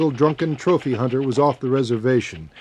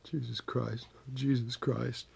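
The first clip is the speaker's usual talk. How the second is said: very quiet, repeated under the breath; hushed murmur of a frightened man; real soft; 13 dB quieter, slower than usual. A narrator's reading. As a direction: say it faintly, like cussing or praying. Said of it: "It was real soft".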